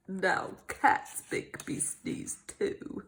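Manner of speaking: low, dry, whispered; deadpan. Acted. Doctor Cat grumbling after being sneezed on. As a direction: mutter it flatly.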